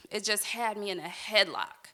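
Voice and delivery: normal talking voice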